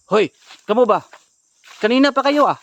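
high, very loud shouting; angry confrontation. Real, not acted. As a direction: shout them down as you catch them. Confronts people who are causing trouble. (pasigaw na paninita)

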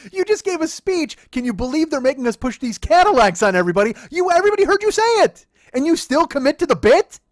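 high, loud, disbelieving rant; incredulous rant. Retold or imitated. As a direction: let it rip in amazed disbelief. Retelling his outraged reply to clerk.

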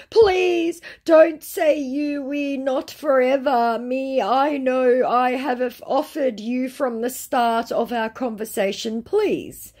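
slow, high, hammed-up pleading; mock desperate. Scammer begging not to be refused forever, mock desperate. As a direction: drag the words out in exaggerated despair.

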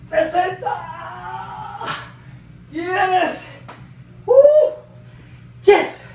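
high, loud victory yell; triumphant shout. Real, not acted. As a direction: yell it out, set finished. Final rep, triumphant shout.

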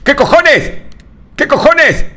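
high, loud panicked outburst; shocked shriek. Real, not acted. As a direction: yelp it out in real fright. Startled by something in horror game. (grito de susto, agudo y fuerte)

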